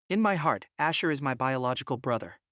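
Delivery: usual speaking voice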